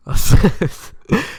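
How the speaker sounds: short amused laugh rising; real laugh. Real, not acted. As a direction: laugh at how hilarious it was. Laughs at Poseidon costume.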